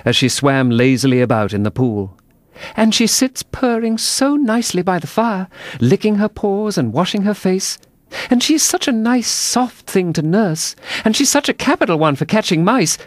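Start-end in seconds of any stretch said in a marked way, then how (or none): 2.8-13.0 high-pitched character voice, rambling fondly; chattering Alice. A narrator's reading. Alice praises her cat to the mouse, high fond chatter. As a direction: voice Alice prattling happily, well up in pitch.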